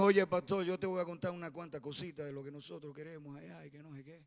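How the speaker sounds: put-on quick, hushed Cuban accent; tossed-off Cuban imitation, fast and soft; imitación ligera de acento cubano. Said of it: "Así con ese acento".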